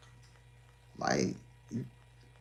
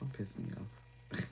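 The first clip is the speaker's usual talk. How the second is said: slow, very soft don't piss me off; quiet slowed-down warning; slow hushed warning; 4 semitones lower, 10 dB quieter, slower than usual. Shown as a demonstration. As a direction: let it drop to a low murmur. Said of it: "You know, I be like".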